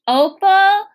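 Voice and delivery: loud, high name call. A narrator's reading. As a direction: call a child from the porch. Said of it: "I heard the preacher call".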